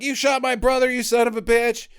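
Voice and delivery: high-pitched acted outrage; mock angry accusation. Brother to his brother's killer, high mock fury.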